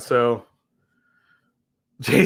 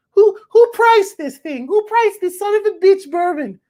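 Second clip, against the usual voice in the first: very high, hammed-up outraged mother; shriek-pitched caricature of an indignant mom; mock mom voice. Mom raging at the bourbon's price, high-pitched mocking impression. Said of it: "the voice I do for, for my mom".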